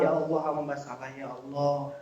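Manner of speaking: very soft plea; penitent confession. Acted. Preacher models asking Allah for forgiveness, soft and penitent. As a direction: confess quietly to God, voice sinking. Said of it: "Minta ampun kepada Allah, ngaku salah".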